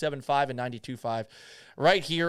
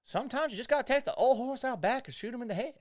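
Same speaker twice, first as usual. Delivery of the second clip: higher, put-on Southern accent for a folksy saying; 8 semitones higher than usual. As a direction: deliver it like an old Southern farmer's proverb, Southern accent. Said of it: "a Southern colloquialism".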